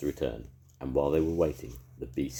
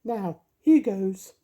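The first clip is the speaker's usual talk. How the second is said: very high, louder fairy voice; comforting fairy; 9 dB louder, much slower than usual. A narrator's reading. Mavis comforting the ice lolly in a high fairy voice. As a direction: soothe him in a tiny high fairy voice. Mavis tries her wand.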